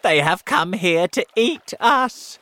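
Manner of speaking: loud, high wail; alarmed cry. A narrator's reading. Earthworm sure the sharks will eat them, alarmed cry. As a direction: cry out in acted panic. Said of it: "cried the earthworm".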